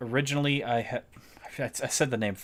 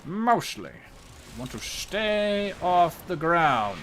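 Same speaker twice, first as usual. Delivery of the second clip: drawn-out, high-pitched silly emphasis; put-on voice, slow and high; hammed-up voice; 7 semitones higher, much slower than usual. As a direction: stretch it out slowly in a higher silly voice. Hammed-up repeat after plain mostly.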